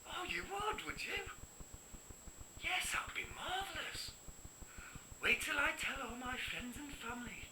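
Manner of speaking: high-pitched, starstruck phone voice; gushing delight. Acted. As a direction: gush politely to someone important. Delighted call from a film director.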